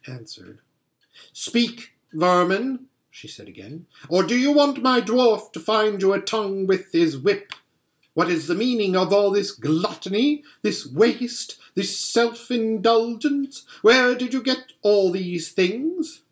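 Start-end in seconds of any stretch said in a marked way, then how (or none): 1.3-2.9 high, scornful witch queen; contemptuous command, pitched up; imperious witch. A narrator's reading. Witch threatens the animals. 4.1-16.2 higher, imperious witch voice; haughty indignation, pitched up; angry witch queen. A narrator's reading.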